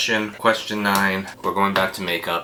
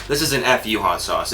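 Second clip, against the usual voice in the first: ordinary talk, nothing marked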